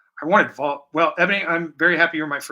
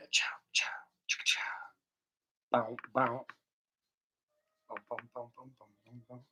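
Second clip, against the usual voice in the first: beatbox; 4 semitones lower, 11 dB quieter, faster than usual. Acted. Beatboxing while painting.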